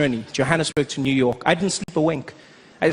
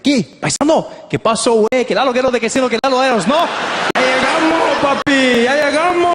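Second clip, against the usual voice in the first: excited Mexican Spanish chatter; 7 semitones higher than usual. Retold or imitated. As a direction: greet a fellow Mexican warmly. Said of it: "He's like".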